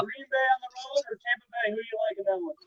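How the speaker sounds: muffled, distant mic sound; finger over the mic. Real, not acted. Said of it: "Blue, your mic's messed up".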